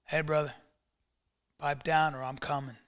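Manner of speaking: low, hushed tough-guy warning; quiet mock threat. Tough guy telling a brother off, low and quiet. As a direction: threaten softly, play-acting. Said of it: "Or how about this? Watch this".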